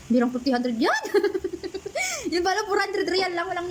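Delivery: high amused laughter; laughing. Real, not acted. Helper laughing to viewers that it was only four hundred.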